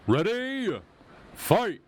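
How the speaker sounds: big arena announcer calling the round; booming fighting game announcer. Shown as a demonstration. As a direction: belt it out like a fighting game intro. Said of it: "I think I did that a little too well".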